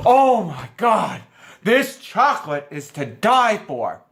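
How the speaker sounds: slow, drawn-out mock rapture; overly enthusiastic woman. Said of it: "being so overly enthusiastic about something so simple".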